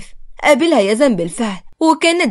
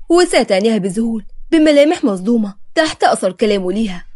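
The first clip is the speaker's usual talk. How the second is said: usual speaking voice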